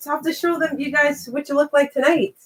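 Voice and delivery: normal talking voice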